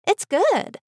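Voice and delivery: put-on high, sweet little girl; a small girl pleased with her food; child's voice. A narrator's reading. Daughter praising the soup, high child voice. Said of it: "she said".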